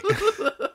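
short high laugh. Real, not acted. Laughing at arrest.

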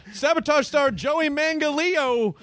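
slow, high announcer read; mock headline announcer. Co-host mocking the next day's headline, slow announcer voice. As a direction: announce the botched name slowly.

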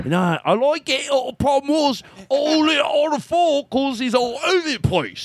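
high, drawn-out mock Australian; strained, over-the-top Australian accent; Australian friend. Replying as Andrew's friend, hammed-up Australian accent. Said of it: "I'll be his friend".

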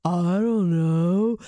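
slow, dazed mock-stoned answer; stoned dad impression. Stoned dad answering his angry wife, slow and dazed. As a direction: drag it out like someone very stoned. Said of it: "and he goes".

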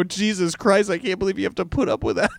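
playful exasperation; mock dismay. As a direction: blurt it out high and fast, appalled for laughs. Acts out dismay at himself.

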